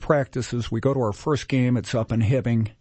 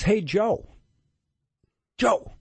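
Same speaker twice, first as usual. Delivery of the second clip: imitated calling out; 9 semitones higher, slower than usual. Hostess hailing a man who is walking out, imitated high call. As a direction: call a name out lightly, pitched up high. Woman calls out to a stranger.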